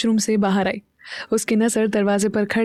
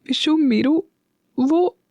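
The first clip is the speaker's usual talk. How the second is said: choked, higher and pleading; a voice wet with tears; tearful voice; 6 semitones higher, slower than usual. A narrator's reading. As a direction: say it through tears. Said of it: "युवी नम आवाज में बोला".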